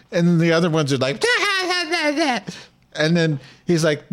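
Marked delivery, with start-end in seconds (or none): 1.2-2.4 high falsetto taunting da da; sing-song mockery of the crowd; squeaky high taunting nonsense; mock taunting kids. Kids voiced in retold fight.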